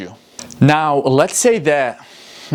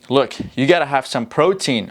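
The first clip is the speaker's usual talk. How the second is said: ordinary delivery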